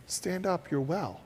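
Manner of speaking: speaking softly to one person; soft spoken aside; quiet aside. Shown as a demonstration. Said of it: "if I say to my friend right here".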